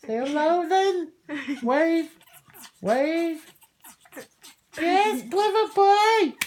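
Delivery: high, slow, sing-song praise; pet praise voice. Acted. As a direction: coo it like praising a clever pet.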